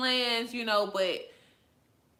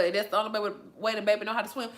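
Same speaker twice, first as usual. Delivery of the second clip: ordinary talk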